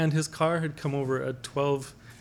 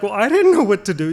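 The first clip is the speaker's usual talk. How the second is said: loud flustered mom; 6 semitones higher, 9 dB louder, faster than usual. Retold or imitated. Mom excuse retold loudly.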